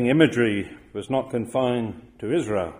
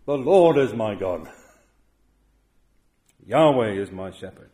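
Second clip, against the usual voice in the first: louder, firm stress on Lord and Yahweh; emphatic declaration; 4 dB louder than usual. Shown as a demonstration. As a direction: proclaim it, leaning on Lord. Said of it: "He says emphatically".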